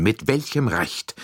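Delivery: slow, indignant outburst; a touch of the count's temper rising; flaring up. Acted. Count demands by what right Poirot asks, mildly indignant. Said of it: "rief der Graf aufbrausend".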